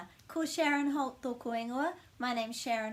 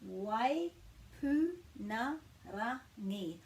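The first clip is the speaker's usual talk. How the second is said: "Waipunarangi" very slowly, each syllable apart; slow teaching breakdown of "Waipunarangi"; much slower than usual. Shown as a demonstration. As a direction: break it into Wai, pu, na, ra, ngi with small pauses. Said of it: "talking about the pronunciation of the star".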